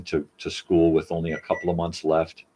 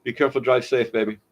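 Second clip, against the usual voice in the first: goodbye at the door; 9 semitones higher, 4 dB louder than usual. Real, not acted.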